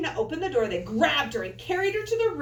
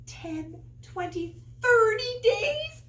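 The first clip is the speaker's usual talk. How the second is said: amazed question; 10 semitones higher, 4 dB louder, slower than usual. Real, not acted. Reader amazed at count.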